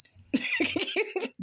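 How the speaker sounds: high-pitched incredulous laughter; laughing. Real, not acted. Vlogger laughing at an outrage that isn't really funny, laughing. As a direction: break into a real, high laugh. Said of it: "I'm laughing".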